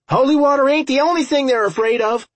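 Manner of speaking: high, fast shout. A narrator's reading. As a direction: holler it quickly over the battle. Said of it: "Milo shouted".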